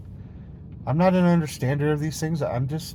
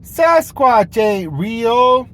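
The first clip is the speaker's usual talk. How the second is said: higher, louder, very slow put-on voice; mock self-assurance; 11 semitones higher, 12 dB louder, much slower than usual. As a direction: drag out each word in a silly voice. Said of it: "you say".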